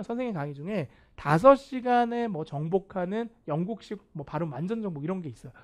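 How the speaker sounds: ordinary delivery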